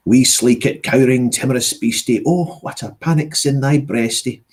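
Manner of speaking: reciting Burns in broad Scots; Scottish accent. Shown as a demonstration. Narrator showing a party trick, Burns poetry in Scottish accent. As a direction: roll out the verse in a Scottish accent. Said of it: "I always like a Scottish accent".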